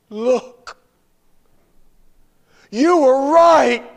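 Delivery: put-on high, slow, wondering child's voice; a girl's delighted discovery, voiced high; excited girl's voice. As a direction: read it out like an amazed little girl. Girl reading the card aloud.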